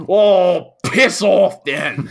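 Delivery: loud, high, drawn-out mock British accent; British lad retort. Imitating drunk kid answering the bouncer, loud British dismissal. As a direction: blurt it out in a thick British accent. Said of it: "and he's like".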